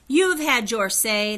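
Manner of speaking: loud, slow royal shout. A narrator's reading. High King shouting at the children, slow and loud. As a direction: boom each word down at them. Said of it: "the High King shouted".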